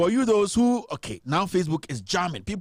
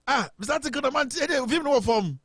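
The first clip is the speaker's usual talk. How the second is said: delighted recognition; 9 semitones higher than usual. Real, not acted. Host recognizes caller's nickname.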